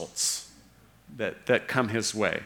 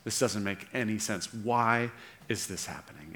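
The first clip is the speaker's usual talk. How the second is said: soft, bewildered plea; preacher voicing Jesus' confused cry, hushed; hushed despair; 4 semitones lower, 8 dB quieter than usual. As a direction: ask it quietly, lost. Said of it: "he cries out".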